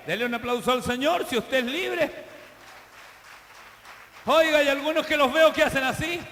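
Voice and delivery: loud, rising pulpit exhortation; preaching at full force. Acted. Pastor rallying his congregation with a loud, fervent preaching voice. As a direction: preach it out to lift the whole church.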